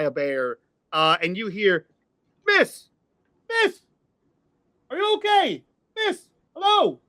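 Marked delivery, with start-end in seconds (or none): 2.5-7.0 put-on high, urgent calls after a running girl; calling out. Neighbor calling after a fleeing girl, high urgent call. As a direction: shout after her from the porch.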